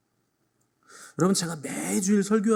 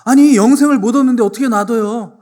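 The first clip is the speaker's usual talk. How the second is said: loud, higher protest; alarmed protest; 8 semitones higher, 13 dB louder than usual. Acted. Pastor voicing a worried churchgoer protesting loudly. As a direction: cry out in worried disbelief.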